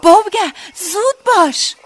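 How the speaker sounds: very high, loud urgent yell; shouting. A narrator's reading. Daughter hurrying her frozen father along, shouted. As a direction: shout to jolt someone into moving. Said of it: "دوباره فریاد زدم".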